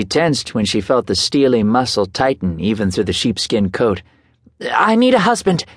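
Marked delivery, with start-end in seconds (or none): 4.7-5.7 high, breathy and urgent; breathless. A narrator's reading. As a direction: blurt it out of breath. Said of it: "she said in a breathless voice".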